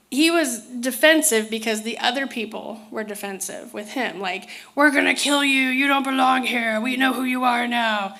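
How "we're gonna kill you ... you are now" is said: hostile threat. Retold or imitated. Speaker imitating beings who tell her they will kill her. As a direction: lightly voice a defensive threat.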